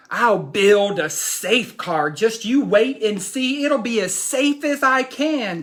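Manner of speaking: put-on lively read-aloud as young Jack; animated picture-book reading; reading to children. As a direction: tell the boy's boast to the class.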